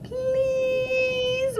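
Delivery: very high, drawn-out whining please; whiny begging. Retold or imitated. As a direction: beg with puppy dog eyes. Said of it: "and he'll be like".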